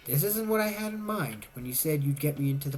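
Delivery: usual speaking voice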